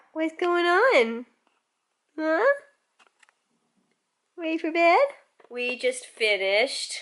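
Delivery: slow, cooing pet voice; drawn-out coo to a sleepy dog. Real, not acted. Talking to the dog.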